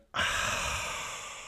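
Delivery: breathy sigh out; exasperated sigh. Real, not acted. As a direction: let out a weary sigh. Exasperated at himself.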